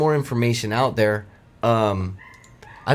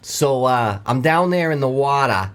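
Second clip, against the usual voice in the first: lightly voiced Mainer lobsterman telling his tale; Maine accent. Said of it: "from a Mainer perspective".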